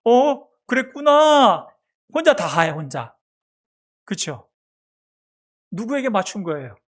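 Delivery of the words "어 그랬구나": put-on high, loud, slow baby talk; mother echoing her baby. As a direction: stretch out an exaggerated "oh, I see" tone for an infant. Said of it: "이렇게 또 맞장구를 쳐요".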